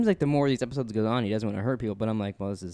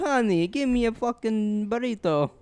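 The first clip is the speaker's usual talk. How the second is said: slow hammed-up Italian accent; mock Italian mobster drawl; 9 semitones higher, much slower than usual. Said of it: "the worst accents I've ever heard".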